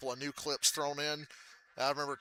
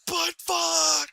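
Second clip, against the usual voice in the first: higher mock outburst; mock shout; 6 dB louder than usual. Retelling the Twizzlers outburst, mock shout. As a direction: replay the curse, less loud.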